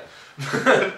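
short, loud, high laugh. Real, not acted. Amused by his own cheeky joke, a short laugh. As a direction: burst out in a brief laugh.

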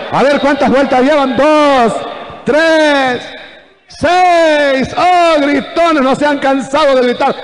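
high, loud taunting count; mocking jeers. Soldiers on the wall taunt the marching army, mocking. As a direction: call out the count in mockery, pitched high. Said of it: "Las burlas de los soldados".

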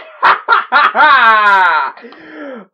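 loud, high burst of laughter; long, real cackle of joy; elated laugh. Real, not acted. Elated reaction to thumbnail.

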